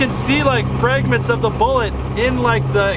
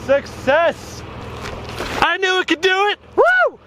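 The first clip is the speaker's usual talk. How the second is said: high-pitched triumphant calling; high, elated exclaiming; excited yelling; 9 semitones higher than usual. Real, not acted. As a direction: yell happily as if wearing earplugs. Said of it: "I'm probably yelling really loud".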